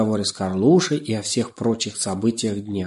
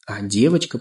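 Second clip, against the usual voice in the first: usual speaking voice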